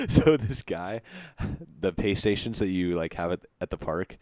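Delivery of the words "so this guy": giggles high before starting his story; laughing. Real, not acted. Podcast guest giggling before a quick work story, laughing. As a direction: breaks into a laugh as he begins.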